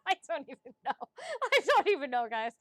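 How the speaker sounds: loud, helpless laughing through words. Real, not acted. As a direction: talk through a real laughing fit. Laughing after an apology.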